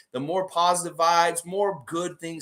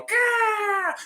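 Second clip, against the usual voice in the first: hawk caw. Retold or imitated. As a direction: screech it like a diving hawk. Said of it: "there's a hawk coming from the tree going".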